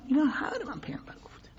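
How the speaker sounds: breaks into a fast, tearful whisper; choked crying. Real, not acted. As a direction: whisper it through real tears. Preacher weeping over the Prophet's words.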